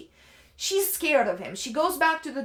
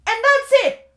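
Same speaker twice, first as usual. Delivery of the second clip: much higher and louder, fed up; loud exasperated cry; exasperated outburst; 12 semitones higher, 11 dB louder than usual. Real, not acted. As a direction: throw it up in disbelief. Exasperated at dropped plot.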